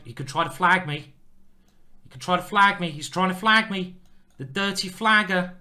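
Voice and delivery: high, loud mock indignation; loudly accusing, in jest; mock outrage. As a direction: play up being outraged by a sneaky opponent. Mock outrage at being flagged.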